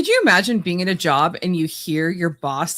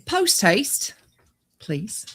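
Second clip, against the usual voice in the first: British accent demo; 3 semitones higher, much slower than usual. Shown as a demonstration. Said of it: "in your best British accent".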